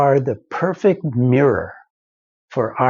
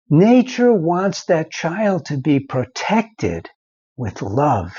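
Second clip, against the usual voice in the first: usual speaking voice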